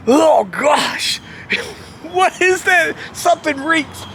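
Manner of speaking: high, strained disgust; mock revulsion. One guy in the ring reeling, mock disgusted. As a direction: imitate someone gagging at a smell. Wrestler in ring reacting to stench.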